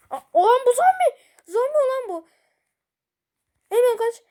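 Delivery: high, loud alarmed yelling; panicked shout. Real, not acted. Gamer spotting a zombie, shouting in panic. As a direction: yell in fright at the zombie.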